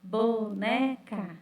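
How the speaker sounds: "boneca" drawn out slowly, bo-ne-ca; "boneca" with the added ca stressed; syllables shown. Shown as a demonstration. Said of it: "se eu pôr mais o K".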